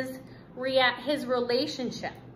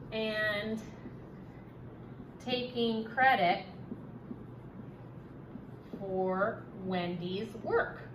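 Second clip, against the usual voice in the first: drawn-out words with long gaps; slow dictation; 6 dB quieter, slower than usual. Shown as a demonstration. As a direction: speak it piece by piece while writing. Teacher dictates while writing.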